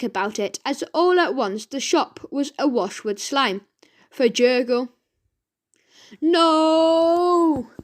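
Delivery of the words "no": high, loud, drawn out no; shout. Acted. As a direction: protest loudly. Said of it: "shouted the pair".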